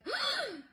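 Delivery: high-pitched theatrical gasp; mock shocked gasp. Mom reacting to the insult, high mock gasp. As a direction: gasp like she's been slapped. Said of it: "And she was like".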